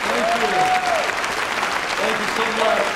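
loud, overwhelmed thanks over applause; excited loud wow. Real, not acted. Guest pastor to the cheering congregation, loud and delighted. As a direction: call out your amazement to the crowd.

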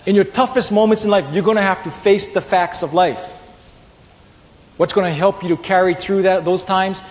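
loud, high preaching; loud, rising sermon delivery. Acted. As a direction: drive the point home to the congregation. Preaching at full force.

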